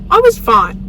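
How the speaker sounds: "fine" said with a loud, hammed-up fall; mock dramatic reassurance; dramatic "I was fine". Shown as a demonstration. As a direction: overplay "I was fine" loudly. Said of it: "I'm being dramatic".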